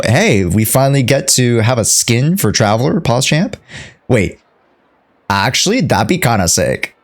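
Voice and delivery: normal talking voice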